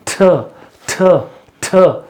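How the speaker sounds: थ said with a strong breathy puff; aspirated थ. Shown as a demonstration. Teacher repeats the aspirated T sound. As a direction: push air out hard on थ. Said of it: "मैं फिर बोल रहा हूँ थ".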